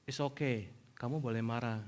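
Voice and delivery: low, very soft fatherly voice; calm an upset child quietly; gentle reassurance. Retold or imitated. Father telling his angry child it's allowed, soft and reassuring.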